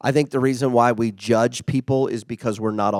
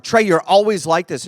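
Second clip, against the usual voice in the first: higher, louder accusing outburst; mock blow-up; 8 semitones higher, 7 dB louder than usual. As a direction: snap at him like a fed-up spouse. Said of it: "I blow up at Trey".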